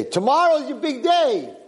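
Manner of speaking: high-pitched mock announcement; slow, high proclamation to a servant; master's gleeful announcement. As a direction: call it out high and drawn out. Masters taunt the servant boy.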